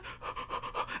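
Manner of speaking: quick mock hyperventilating breaths; hammed-up breathless gasps; panicked gasping. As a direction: gasp rapidly in pretend panic. Acted panic.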